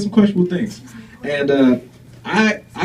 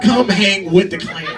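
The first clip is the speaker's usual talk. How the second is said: repeated slogan, laughing; 3 semitones higher than usual. Real, not acted. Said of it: "I said it".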